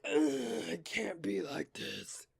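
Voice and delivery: slow, growling monster voice. Retold or imitated. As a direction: groan it out like a grumpy monster. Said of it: "monster voice irritated my sore throat".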